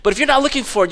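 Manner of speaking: normal talking voice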